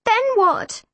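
high-pitched, frantic question; urgent question, pitched right up; crying out. A narrator's reading. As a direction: cry it out in alarm. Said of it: "Shona cried".

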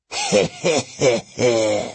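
asthmatic wheezing laugh; wheezy laugh. A narrator's reading. Oreus the bear twin laughing at Luke's joke, wheezing. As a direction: laugh like a bear gasping for air. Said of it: "His brother Oreus laughed".

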